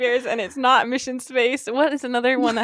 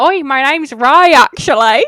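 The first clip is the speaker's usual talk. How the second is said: put-on Cockney accent; 10 dB louder than usual. Friend mocking Rey's voice in mild Cockney. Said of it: "you did a Cockney accent".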